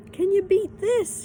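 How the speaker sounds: high, loud and slow call; excited cry. A narrator's reading. Pippi calling down to the children, high excited cry. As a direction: call out in sudden delight. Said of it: "she cried suddenly".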